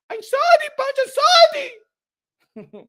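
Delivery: very high, loud squeaky apology; hammed-up high-pitched sorry; imitating the cashier apologizing. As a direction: squeal the apology like the shop lady. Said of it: "la china me dijo".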